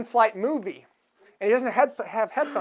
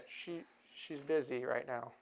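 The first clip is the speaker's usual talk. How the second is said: much lower and softer, a smug murmur; sly low aside; 8 semitones lower, 16 dB quieter than usual. Retold or imitated. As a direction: lean over and mutter it slyly. Nicholson character voiced in retelling.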